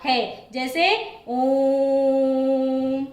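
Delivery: ओम chanted, vowel held three times long; prolonged vowel. Shown as a demonstration. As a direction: draw out ओम like a slow chant. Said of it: "हर्ष से तिगुना समय लगता".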